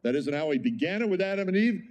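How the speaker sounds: ordinary delivery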